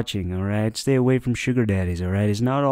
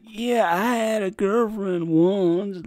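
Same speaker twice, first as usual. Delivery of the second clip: higher, slow, dopey mock of the guest; mocking imitation; 7 semitones higher, slower than usual. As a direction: drag it out like a clueless guy.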